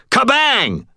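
panicked shout. A narrator's reading. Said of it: "I whirled in panic and shouted".

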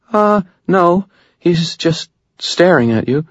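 nervous little report; frightened girl. Acted. Girl tells the wizard the troll is only staring.

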